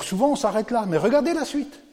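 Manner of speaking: eager preacher's build; rising urgency. Real, not acted. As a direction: lift them toward the next verse. Preacher urging to read on.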